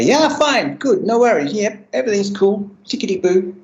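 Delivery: sing-song I'm totally fine act; fake breezy cheer. Faking fine to the counsellor, bright breezy brush-off.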